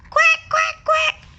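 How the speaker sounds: high, loud duck quacks; excited quacking. Acted. Ducks quacking as the man reaches, high and loud.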